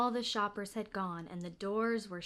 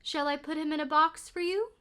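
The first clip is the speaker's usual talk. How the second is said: higher, polite shop voice; saleslady; 7 semitones higher, 5 dB louder than usual. A narrator's reading. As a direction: offer it like a helpful clerk.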